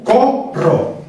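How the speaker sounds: "Corro" separado en co-rro, con fuerza en "co"; acento inicial. Shown as a demonstration. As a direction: marca el primer tiempo de "Corro". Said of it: "El acento está en el primer tiempo". ("Corro" silabeado, golpe en la primera sílaba)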